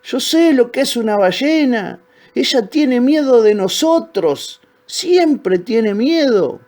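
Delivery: high-pitched, insistent calling out; shouted. A narrator's reading. Old crocodile calming the frightened little ones, shouting. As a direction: proclaim it high, stretching the words. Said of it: "les gritó".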